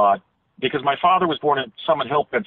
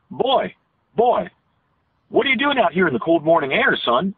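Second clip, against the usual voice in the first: higher-pitched sing-song Eastern Pennsylvania accent; sing-song rural Pennsylvania lilt; 5 semitones higher than usual. Shown as a demonstration. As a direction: imitate an old man's lilting rural Pennsylvania speech. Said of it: "it kinda had a sing-songy quality".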